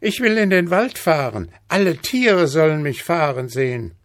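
put-on high-pitched bossy child; Häwelmann. A narrator's reading. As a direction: demand it like a spoiled little boy. (hohe, fordernde Kinderstimme)

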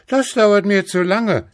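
put-on high, loud impatient child; Häwelmann. A narrator's reading. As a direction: complain like a bossy little boy. (hohe, laute, ungeduldige Kinderstimme)

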